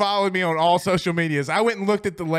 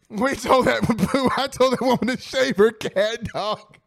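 laughing while talking; 4 semitones higher than usual. Real, not acted. Laughing retelling the joke.

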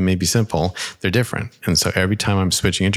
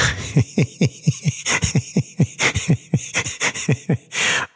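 high, breathy run of giggles; real giggling fit; 8 semitones higher than usual. Real, not acted. Host cracking up after his own foghorn noise, giggling. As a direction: crack up helplessly at your own silliness.

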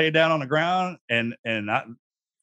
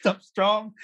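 tough-guy reply; 5 semitones higher, much slower than usual. Retold or imitated. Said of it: "my first reaction was like".

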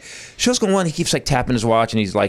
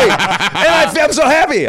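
loud, high burst of joy; mock elation; 12 semitones higher, 5 dB louder than usual. Comic acting out his feelings, mock elated. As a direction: belt out the happiness, drawn out.

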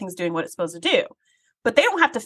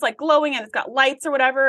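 ordinary talk, nothing marked

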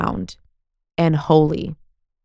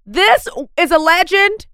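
amazed; 9 semitones higher than usual. Retold or imitated. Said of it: "I'm like".

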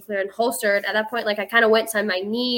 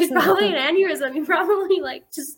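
laughing; 7 semitones higher, 3 dB louder than usual. Retold or imitated. Quoting mom while laughing.

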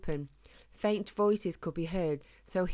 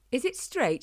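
put-on lightly posh old lady, pitched high; a touch of posh English queen; 7 semitones higher, 4 dB louder than usual. A narrator's reading. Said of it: "in the same posh voice".

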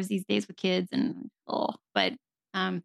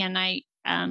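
ordinary talk, nothing marked

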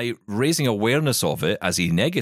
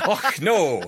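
imitating Scottish; 7 semitones higher than usual. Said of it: "A bit Scottish there".

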